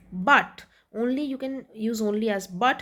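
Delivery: ordinary delivery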